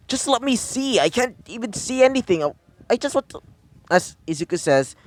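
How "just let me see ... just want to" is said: high, insistent little creature voice; pleading Jessamon. Acted. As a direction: plead in a much higher put-on voice. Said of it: "As Jessamon says".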